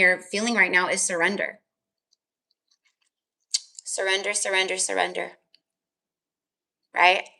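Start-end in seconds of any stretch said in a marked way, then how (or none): none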